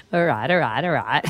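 Matthew McConaughey. Acted. Radio host copying a played clip, low impression. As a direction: copy the film star's low catchphrase.

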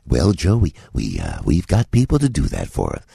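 a deep, folksy Reagan impression. To a young comedian, Reagan's low easygoing reply. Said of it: "That's what Ronald Reagan said to me".

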